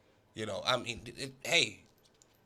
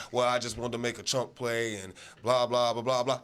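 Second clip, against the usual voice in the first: mock Brady dodge; 5 semitones lower than usual. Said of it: "voicing Brady's evasive answer".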